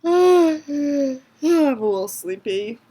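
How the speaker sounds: ordinary delivery, nothing marked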